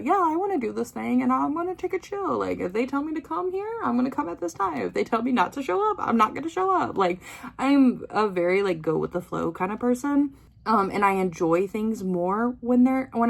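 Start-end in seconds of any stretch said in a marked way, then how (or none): 0.0-7.0 higher-pitched, sing-along casual self-quote; easygoing self-impression. Concertgoer voicing her own go-with-the-flow side, easygoing. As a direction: play the agreeable go-with-the-flow fan, pitched up. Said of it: "I'm kind of like the".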